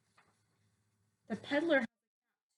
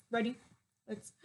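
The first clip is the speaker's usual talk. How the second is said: plain reading voice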